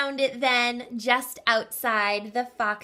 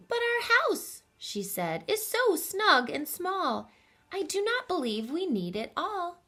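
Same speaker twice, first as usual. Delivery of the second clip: wife's gentle protest; 9 semitones higher than usual. Acted. Wife's quoted reply.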